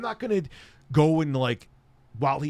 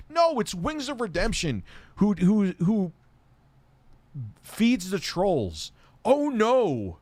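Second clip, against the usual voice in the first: high, drawn-out sarcasm; sarcastic mock alarm; 8 semitones higher, slower than usual. Real, not acted. Streamer ridiculing trolls' supposed victory over an evil villain, sarcastic mock alarm. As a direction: feign horror at a trivial crime.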